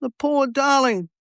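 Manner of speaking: high, tender pity; lightly voiced sympathetic wife. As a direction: mimic the wife feeling for her idol. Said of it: "And she said".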